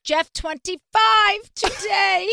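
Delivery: high, loud, drawn-out promo shout; calling out. Real, not acted. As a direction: sing out the discount code like a hawker. Calling out her discount code.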